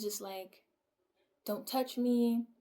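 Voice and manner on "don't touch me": slow, louder retold warning; mildly aggressive. Retelling partner's outburst during a bad trip, mildly aggressive. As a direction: imitate an aggressive demand, drawn out. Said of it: "and he's aggressive".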